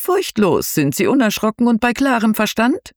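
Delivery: high, showy ghost-tour host voice; theatrical tour guide. Acted. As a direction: play the spooky guide with flair. Ghost tour guide addresses group.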